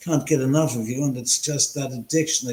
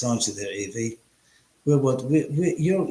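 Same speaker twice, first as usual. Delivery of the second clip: usual speaking voice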